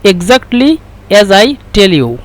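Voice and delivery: slow, careful English; slow English gloss. Shown as a demonstration. Teacher translating the Egyptian phrase slowly in English. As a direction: spell the sentence out slowly. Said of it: "يعني".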